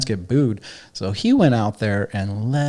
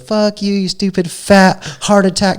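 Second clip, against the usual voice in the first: high, louder mock tirade, slowing on the insults; imitated insult rant; 8 semitones higher, 5 dB louder than usual. Comic insulting the Philly crowd, loud mock tirade. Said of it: "And he goes off and he goes".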